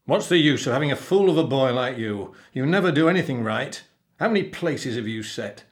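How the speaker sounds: loud, scolding pirate growl; growling captain. A narrator's reading. Captain berates the cabin boy over dinner places, growling. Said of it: "the captain growled".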